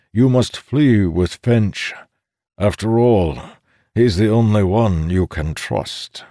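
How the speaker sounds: put-on a commanding old sage; old codger voice. A narrator's reading. Codger character voice.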